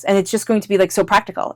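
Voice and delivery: ordinary delivery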